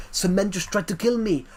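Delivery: put-on accented action star protesting, ending on a barked command; hammed-up Austrian action-hero voice; Arnold Schwarzenegger. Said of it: "When I try and do a Terminator voice".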